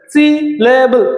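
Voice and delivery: সিলেবল slowly divided as সি-লে-বল; syllable by syllable. Shown as a demonstration. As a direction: stretch সিলেবল over three clear syllables. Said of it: "তিন ভাবে উচ্চারণ". (তিন ভাগে ধীরে সিলেবল)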